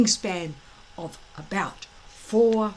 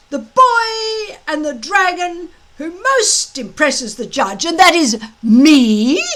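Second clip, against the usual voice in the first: loud, high, gloating drill-master voice; a big blustering Viking instructor gloating at his recruits; booming Gobber; 8 semitones higher, 10 dB louder than usual. Acted. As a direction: lord it over the boys with relish. Gobber boasts as judge.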